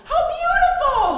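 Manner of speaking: high, slow, loud cackling delight; gloating laugh. Acted. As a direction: relish the horror with a laugh. Aunt gloating over the cutting.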